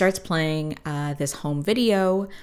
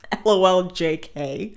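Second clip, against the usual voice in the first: usual speaking voice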